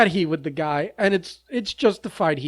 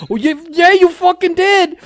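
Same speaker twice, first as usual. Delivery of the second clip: put-on high, louder Boston accent, slowing to the end; [name]'s Boston accent, much higher and louder; 12 semitones higher, 7 dB louder than usual. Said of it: "with that Boston accent".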